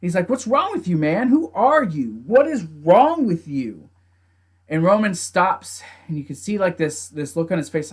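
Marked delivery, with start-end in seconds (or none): 0.3-3.9 higher-pitched disbelief, slowing on the last question; appalled confrontation. Retold or imitated. Jimmy confronting Roman as he covers Jey, appalled. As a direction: imitate a brother demanding answers.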